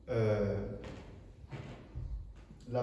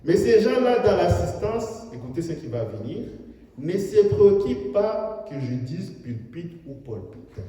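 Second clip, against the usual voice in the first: loud, high fervent sermon delivery; full-force preaching; 5 semitones higher, 8 dB louder than usual. Acted. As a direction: preach it out to the hall. Preacher addresses congregation.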